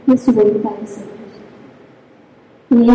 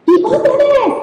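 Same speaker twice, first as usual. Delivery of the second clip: put-on eager child; 12 semitones higher, slower than usual. Daughter asking to go again.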